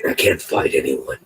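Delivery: deep, far-off Batman growl. Retold or imitated. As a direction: drop into a low Dark Knight rasp. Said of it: "sound like the Dark Knight".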